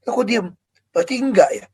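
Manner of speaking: ordinary talk, nothing marked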